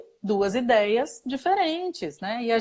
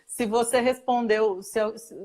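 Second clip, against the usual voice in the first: ordinary talk, nothing marked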